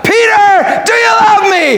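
high, impassioned call across a table; crying out. Acted. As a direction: cry it out at preaching pitch. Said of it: "he cries out".

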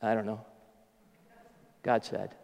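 low, quiet mock-sheepish mumble; small, halting admission with nothing to back it; sheepish non-answer. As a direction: imitate a lame answer, softly and low. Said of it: "he has this very sophisticated response".

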